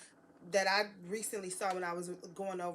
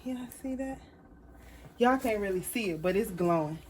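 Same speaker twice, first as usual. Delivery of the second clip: normal talking voice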